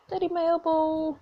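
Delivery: put-on high little-kid whine to daddy; hurt child. Hurt child calls to father.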